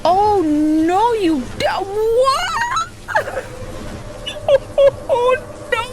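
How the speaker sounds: high, helpless laughing through disbelief; real laughter breaking up the words, pitch way up; shocked laughter. Real, not acted. Player watching a tornado wreck the town, laughing in shock. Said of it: "I'm laughing at the fact".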